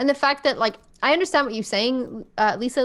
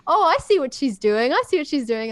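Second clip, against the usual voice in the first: higher, louder knowing call-out; mock knowing suspicion; 5 semitones higher, 5 dB louder than usual. Friend retelling streamers calling out a player, mock knowing. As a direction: act out catching someone at it. Acting out Liam and Todd's suspicion.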